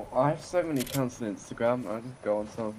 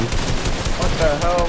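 loud shout; 5 semitones higher, 11 dB louder, much slower than usual. Real, not acted. Shocked by sudden death.

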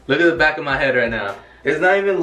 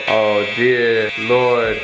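drawn-out, slow, pleading sigh; dramatic dread; 5 semitones lower, much slower than usual. Real, not acted. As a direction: stretch it out like a prayer. Dreading the haircut result.

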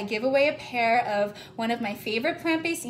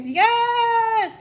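excited yes; 9 semitones higher, 4 dB louder than usual. Real, not acted. Cheering a viewer comment.

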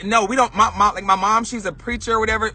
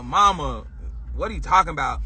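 low, subdued masculine put-on; trying to be masculine; 6 semitones lower, 4 dB quieter, faster than usual. Child denying it to mother, low masculine front. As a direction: drop it low and play it cool. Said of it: "I was trying to be, you know, masculine".

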